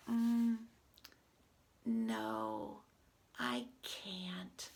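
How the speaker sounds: expressive read-aloud with swooping pitch; animated picture-book dialogue. Acted. Reader voices two friends arguing over pretending, animated. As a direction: act out both characters for young children.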